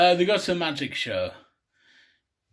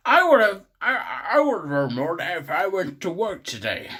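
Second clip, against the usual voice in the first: loud high start, then a strained character voice; put-on complaint; 4 semitones higher, 4 dB louder than usual. Character complains about the sixty cents earned, put-on grumble.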